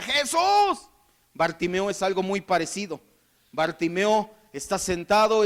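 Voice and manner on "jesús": high, drawn-out call from afar. Acted. Man up a tree calling to Jesus, high drawn-out call. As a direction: call out high to someone far off.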